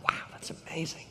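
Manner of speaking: breathless whispered wow; awed whisper. Retold or imitated. Pastor hearing of the big donation, awed whisper. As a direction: whisper it, amazed. Stunned reaction to big gift.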